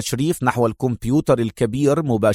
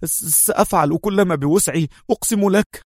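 nervous stuttering promise, higher; stammering mumble; 6 semitones higher than usual. Acted. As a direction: back away stammering out the vow. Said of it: "يتراجع مغمغما". (تلعثم خائف)

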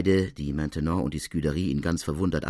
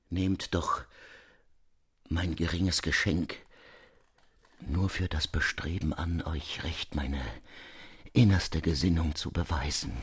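softer pleading; 6 dB quieter than usual. Acted.

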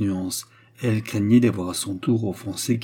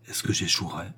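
softer, lower inner worry; anxious thought; 4 semitones lower, 6 dB quieter than usual. A narrator's reading. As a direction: think it anxiously under your breath. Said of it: "pensa la reine inquiète".

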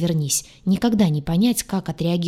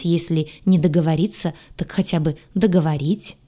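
usual speaking voice

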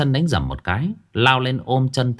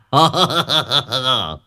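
1 smug laugh; 3 semitones higher than usual. A narrator's reading. Old man gloats.

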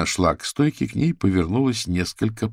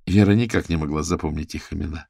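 ordinary delivery

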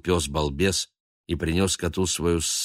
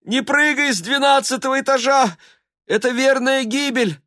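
much higher, louder cry from below; anguished cry; 6 dB louder than usual. A narrator's reading. Murianna crying up from the yard, anguished. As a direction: scream up in alarm. She keeps begging.

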